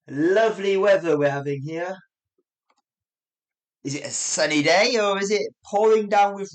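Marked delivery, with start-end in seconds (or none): 0.1-2.0 loud, raised mock appreciation; dry sarcasm about miserable weather; sarcastic. Shown as a demonstration. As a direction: say it meaning the exact opposite. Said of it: "It's a bloody rainy day".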